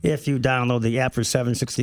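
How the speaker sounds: booming radio announcer intro; big, loud on-air announcer read. Acted. Radio host opening the show.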